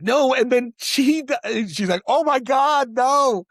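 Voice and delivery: high, loud disbelieving "no"; imitating a stunned reaction; mock shocked gasp. Husband imitating his wife hearing the sequel twist, mock shocked.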